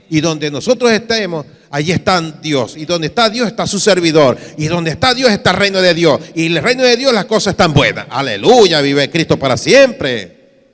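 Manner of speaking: loud, driving sermon climax; full-force preaching. Real, not acted. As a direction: build quickly into a loud hallelujah.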